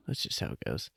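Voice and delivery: whispered. Acted. Mock resigned excuse.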